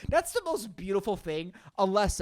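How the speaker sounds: normal talking voice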